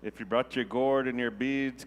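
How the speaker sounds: usual speaking voice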